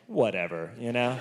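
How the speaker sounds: low, soft shrug of a word; whatever. Retold or imitated. Telling the audience his own resigned inner shrug. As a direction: toss it off, resigned. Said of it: "part of me was like".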